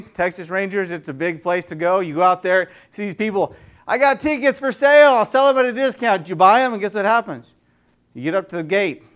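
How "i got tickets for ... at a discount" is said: high, loud hawking call; ticket tout. Acted. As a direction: call out to passers-by like a ticket scalper. Voices a ticket seller at stadium.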